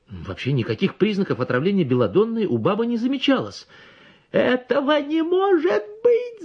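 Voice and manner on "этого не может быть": very high, slow, near tears; doctor crying out in disbelief. A narrator's reading. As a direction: wail it in disbelief. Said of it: "заговорил я и завопил".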